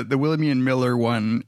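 usual speaking voice

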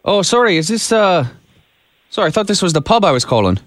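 put-on a light Irish accent on a prank call. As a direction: play an Irish caller hunting for his brother-in-law. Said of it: "Jim is gonna do his best Irish accent".

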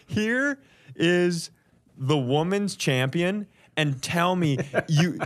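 ordinary delivery

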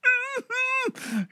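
a short high laugh; high giggle. Real, not acted. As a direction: let a little giggle slip out. Narrator laughs.

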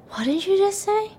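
high, soft, threatening question; angry nurse's hushed menace; menacing. Retold or imitated. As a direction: lean in and warn him. Angry nurse leaning over the table.

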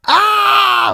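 loud, high-pitched sustained yell. Retold or imitated. Host showing the singer's scream in the song, wild yell. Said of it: "like this [name]".

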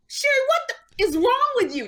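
very high and fast, yelping at herself; frantic inner scold. Retold or imitated. Scolding herself while held by Janet, very high and fast. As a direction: scold yourself in a shrill panic. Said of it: "That's what I was thinking in my head".

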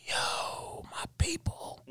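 soft, whispered excitement; hushed awe. Retold or imitated. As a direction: whisper it like spotting your own tribe. Retold hushed thrill spotting his people.

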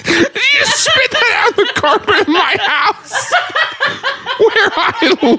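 high incredulous wail through real laughter; high-pitched, tearful laughing disbelief; laughing to tears. Real, not acted. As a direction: protest while crying with laughter. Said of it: "I've got my tears on the carpet".

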